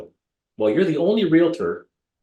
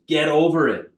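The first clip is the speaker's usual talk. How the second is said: raised, higher retold command; mildly loud, emphatic mock order; stage stomp command; 5 semitones higher, 4 dB louder than usual. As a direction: imitate a coach ordering someone to move on. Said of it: "He stomps on the stage and he goes".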